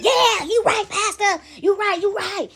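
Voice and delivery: high, mock congregation cheer; egging on the pastor. Congregant feeding off the pastor's words, high and hyped.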